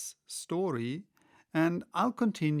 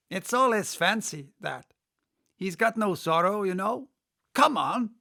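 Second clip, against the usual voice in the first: higher, louder, rough-mannered griffin; brash griffin; 6 semitones higher, 5 dB louder than usual. Acted. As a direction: brush off the queen's fancy with a scoff. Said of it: "very nearly in the same words as before".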